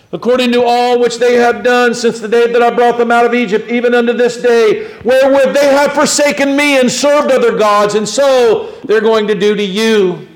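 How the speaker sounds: high-pitched, proclaiming preacher's reading; forceful scripture reading. Acted. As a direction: declare the verse with mounting indignation, then ease down.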